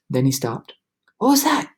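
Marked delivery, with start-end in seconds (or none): 1.2-1.7 sudden higher alarmed question; asked quickly. A narrator's reading. James hearing a distant voice, sudden alarm. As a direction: snap to attention at a noise. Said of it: "he asked quickly".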